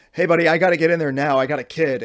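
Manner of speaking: loud, pushy knock-on-the-door demand; impatient father demanding the stall; demanding dad. Retold or imitated. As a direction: bark it through the stall door. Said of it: "He goes".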